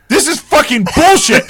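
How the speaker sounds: high mock outburst; retold rage quit. Friend recalling how his buddy shut off the console, mock anger.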